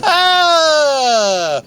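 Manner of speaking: high, loud sarcastic ah; a hammed-up shouted ah; mock excited shout. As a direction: yelp it in fake excitement. Mock cheer for awareness month.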